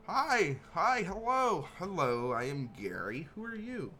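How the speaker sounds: excited goblin. Acted. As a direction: greet him like an overexcited little goblin. Goblin Gary greets the player.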